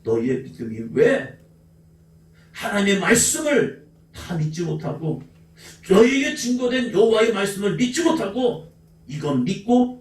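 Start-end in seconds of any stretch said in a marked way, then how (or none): none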